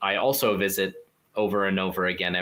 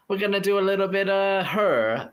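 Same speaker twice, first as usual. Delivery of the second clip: bad Italian accent; 11 semitones higher than usual. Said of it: "the worst Italian accent ever".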